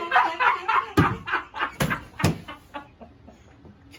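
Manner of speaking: loud giggling fit; breaking up laughing; high burst of laughter. Real, not acted.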